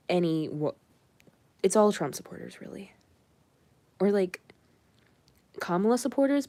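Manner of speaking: normal talking voice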